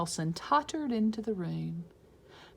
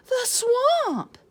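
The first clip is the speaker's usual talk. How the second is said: much higher, louder scared cry; terrified kids; 8 dB louder than usual. Acted. Kids recognising Swamp, high and loud.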